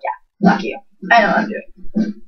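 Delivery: whispered under the breath; whispered retort. Retold or imitated. Daughter snaps back at mom about the dress, whispered. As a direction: hiss it so mom can't hear.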